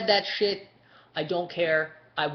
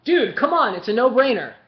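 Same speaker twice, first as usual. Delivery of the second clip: high, loud and incredulous; loud exclamation; 5 semitones higher, 11 dB louder than usual. Real, not acted. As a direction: exclaim it loudly, as if it is obvious. Mock exasperated reply to caller.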